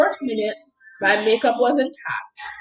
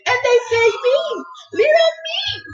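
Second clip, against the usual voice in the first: much higher, thrilled little me; excited disbelief. Real, not acted. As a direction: squeal it with delight at being paid. Excited at being paid.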